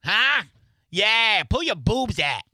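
high, loud, hammed-up Boston accent; mock-sexy Boston accent. Host proving the accent is not sexy, hammed-up Boston accent. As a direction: parody dirty talk in a broad Boston accent. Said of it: "Oh, it's not sexy?".

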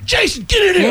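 high, excited summons; urgent call. Retold or imitated. Comedian calling friend to see Twitter, urgent call. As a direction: holler for your friend, voice shooting up.

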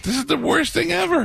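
very high-pitched retold complaint; mock outrage. Fed-up fans react to band playing in the dark, high-pitched mock outrage.

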